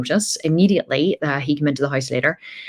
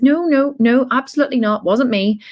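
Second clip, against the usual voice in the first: put-on much higher, louder frantic kid voice; guilty five-year-old denying to her dad; panicky child denial; 9 semitones higher, 4 dB louder than usual.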